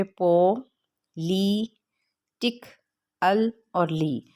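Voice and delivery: पो, ली, टिक, अल, ली each said apart with pauses; syllable by syllable. Shown as a demonstration. Teacher breaking up "politically", पो, ली, टिक, अल, ली spaced out. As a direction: count out पो, ली, टिक, अल, ली as separate beats. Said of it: "ये पांच syllable इसके बनते हैं".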